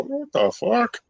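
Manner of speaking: a retold startled reaction; mock disbelief. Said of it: "And I would go".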